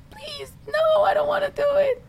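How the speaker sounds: high, whimpering fake-cry plea; whiny crying plea. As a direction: beg like a child about to cry. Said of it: "I'll just cry".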